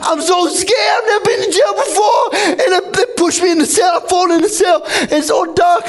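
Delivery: panicked pleading. Acted. Pleading with police after the killing, high panicked wail. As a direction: beg in tearful panic.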